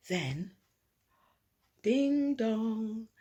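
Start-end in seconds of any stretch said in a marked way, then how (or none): none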